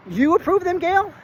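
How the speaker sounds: high, disbelieving question; can't believe what she just heard; incredulous. Retold or imitated. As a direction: pitch shoots way up in disbelief. Incredulous at supervisor's answer.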